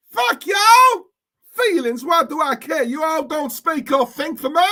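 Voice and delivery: loud, high mock-American rant; American accent. Streamer shows chat his American accent, shouting. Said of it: "let me do it again".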